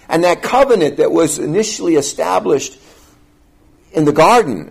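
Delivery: high, loud pulpit delivery; fervent sermon voice, pitched high; forceful preaching. Acted. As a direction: preach it out to the congregation.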